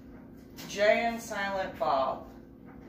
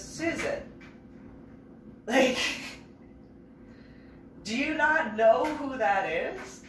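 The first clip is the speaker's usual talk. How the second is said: incredulous friend; 8 semitones higher, slower than usual. Retold or imitated. Ian voiced, incredulous.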